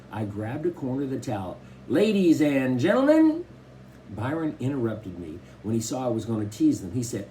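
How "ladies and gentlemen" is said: loud, high showbiz announcement; mock announcer. A narrator's reading. Kenneth teasing the family, mock announcer. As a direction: ham it up like a ringmaster.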